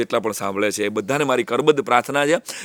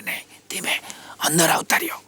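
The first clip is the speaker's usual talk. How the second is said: hushed whisper of a greeting at the door; whispered welcome; 9 dB quieter than usual. Retold or imitated. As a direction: whisper the welcome so the boy studying isn't disturbed. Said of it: "આટલું ધીમેથી અમને અંદર બોલાવ્યા". (ધીમા અવાજે ફફડાટ)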